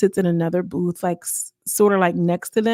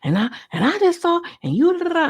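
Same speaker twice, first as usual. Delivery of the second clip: much higher, sing-song mock whining; mock Michelle; 10 semitones higher than usual. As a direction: parody her rambling complaint up high. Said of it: "Then Michelle, she back on it".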